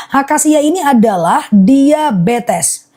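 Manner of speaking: slow and deliberate, stretched syllables; slow, focused repeat. Real, not acted. Seller restarting the acacia list for buyers, slowly. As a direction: lay it out slowly for listeners to follow. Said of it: "aku ulang akasia fokus ya".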